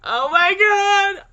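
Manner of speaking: loud, drawn-out high "oh my god"; shocked exclamation. Real, not acted. Gamer reacting to a game twist, loud drawn-out shock. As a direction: blurt it out in disbelief.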